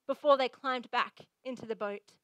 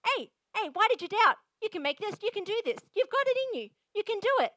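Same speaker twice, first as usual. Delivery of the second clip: higher, louder, cheering encouragement; rousing coach; 6 semitones higher, 5 dB louder than usual. Retold or imitated. Coach cheering on a hurdler, excited and loud. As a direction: pump the athlete up like a coach. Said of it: "A coach says".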